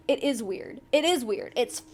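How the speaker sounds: usual speaking voice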